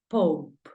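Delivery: "pope" with an open O, one syllable; English pope. Shown as a demonstration. Teacher gives the student the answer for pape, English sounds. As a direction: say pope, final E silent. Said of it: "La pronunciación de papa es pope".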